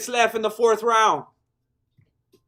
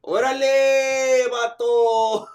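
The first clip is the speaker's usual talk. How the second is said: drawn-out laugh; 4 semitones higher, 3 dB louder, much slower than usual. Real, not acted. Laughing at chatter.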